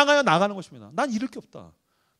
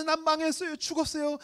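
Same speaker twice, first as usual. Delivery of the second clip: softer, weeping lament; tearful lament; 6 semitones higher, 8 dB quieter than usual. Acted. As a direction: pour it out through tears. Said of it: "기도하고 울고 울고 쏟아내". (울며 쏟아내는 탄식)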